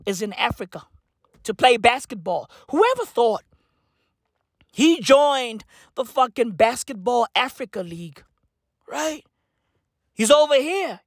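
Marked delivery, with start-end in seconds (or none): none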